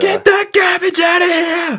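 very high, drawn-out roaring holler; full-voiced play-by-play yell; commentator catchphrase shout. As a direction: bellow it like an announcer on a huge block. Imitates commentator's famous catchphrase.

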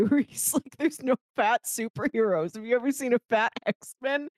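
ordinary talk, nothing marked

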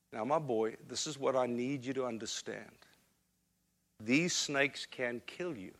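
mother holding her son's face, speaking gently; soft, earnest mother. Retold or imitated. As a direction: speak gently to a small child. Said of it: "she said".

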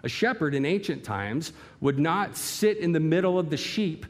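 ordinary delivery

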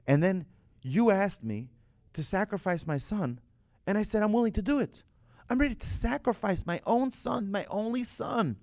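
high-pitched, indignant pleading; acted-out protest, pitched high; impassioned plea. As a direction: plead your case with rising intensity. Abraham pleading his case to God.

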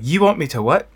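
loud, high mock-Scottish outburst; indignant, badly faked Scottish accent; terrible Scottish accent. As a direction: put on a bad Scottish accent, incredulous. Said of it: "that's my terrible Scottish accent".

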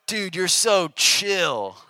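slow, emphatic "dude" voice of a friend; imitating an admiring peer. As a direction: stretch it out like an impressed buddy. Imitating peers calling him chill.

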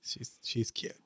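breathy hushed whisper; murmuring a sly secret; whispered aside. Retold or imitated. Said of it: "is just like".

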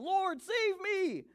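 very high, drawn-out plea; desperate cry, acted. A narrator's reading. Peter sinking in the waves to Jesus, high acted cry for help. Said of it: "he cried out".

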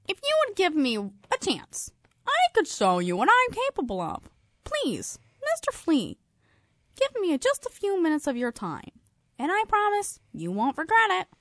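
very high pleading fly. Acted. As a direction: beg in a tiny squeaky voice. Fly begs for a chance.

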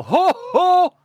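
very high, loud rallying call; crowd call yell. Acted. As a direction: yell it out to the whole arena. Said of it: "I'm just gonna yell".

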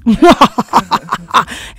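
slow, menacing villain voice; evil voice. Pretend. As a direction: drag it out like a villain, then laugh. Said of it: "In my evil voice".

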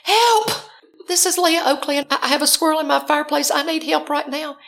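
hammed-up emergency voicemail, anything but sweet; frantic message. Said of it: "left him a real sweet message".

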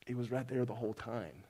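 low, soft, grave delivery; quiet solemn verdict. Retold or imitated. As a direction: state it quietly, as a final judgment. Voices God's verdict on judgment day.

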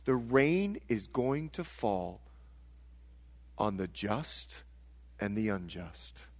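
low, hushed, grave preaching; solemn truth. Real, not acted. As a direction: bring it right down, soft and deliberate, with pauses.

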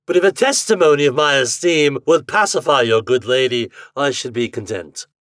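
genteel captain, settling at the end; Captain Bartholomew Roberts. A narrator's reading. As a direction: make a gracious, formal offer. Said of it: "in a gentleman's voice".

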